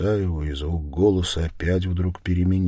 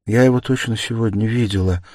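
plain reading voice

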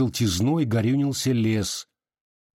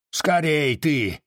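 mild shout, higher and drawn out; impatient cry; 5 semitones higher, slower than usual. A narrator's reading. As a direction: hurry her up with a slow, raised-pitch cry. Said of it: "прикрикнул Пантелей Прокофьевич". (нетерпеливый окрик, протяжно)

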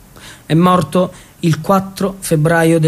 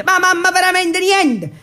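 exasperated protest; 10 semitones higher than usual. Acted. Son protests to mother.

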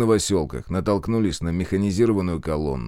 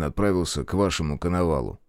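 ordinary talk

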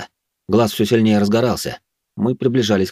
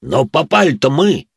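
angry dwarf; 6 semitones higher, 4 dB louder, slower than usual. Acted. Dwarf grabs elf.

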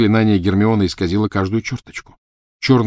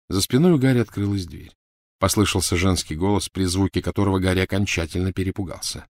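ordinary delivery

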